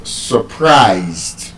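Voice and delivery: surprised said slowly, stressing -prised; quick schwa sur-, long stressed -prised in surprised; stress on second syllable. Shown as a demonstration. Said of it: "it is stressed on the second syllable".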